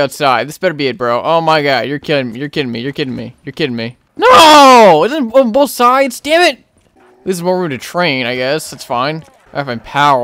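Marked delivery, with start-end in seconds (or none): 4.2-6.6 loud, high yell of dismay; frustrated shout. Real, not acted. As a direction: burst out as the escape is blocked. Finds zombies on both sides.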